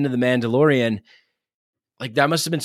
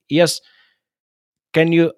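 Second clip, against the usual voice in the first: put-on Indian accent; 5 semitones higher, 5 dB louder than usual. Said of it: "I'm gonna do an Indian accent".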